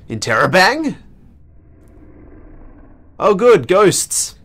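usual speaking voice

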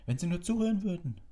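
high, loud exasperation; angry. A narrator's reading. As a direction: burst out in frustration. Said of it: "sagte Harry zornig". (zornig und laut, hohe Stimme)

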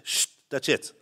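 put-on whispered hush to the kids. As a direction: hiss it, don't say it aloud. Wife hushes the children. (șoptit, potolind copiii)

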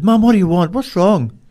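high and loud, anxious question; urgent calling. Retold or imitated. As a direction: burst in demanding what's wrong. Son bursts in asking mother.

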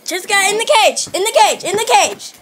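high, loud sing-song call; calling a dog. Real, not acted. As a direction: coax the dog in, loudly.

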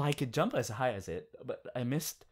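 ordinary talk, nothing marked

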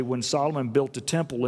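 usual speaking voice

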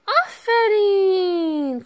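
long, slow, high cheer of praise; sing-song praise. Real, not acted. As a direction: stretch the word out like a happy cheer. Praising the toddler.

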